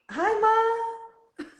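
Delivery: excited greeting. Real, not acted. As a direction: wave hello to mom, excited. Greeting mom watching stream.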